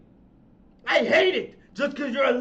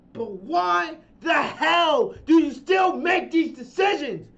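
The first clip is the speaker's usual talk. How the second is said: ordinary talk, nothing marked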